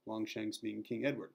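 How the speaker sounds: usual speaking voice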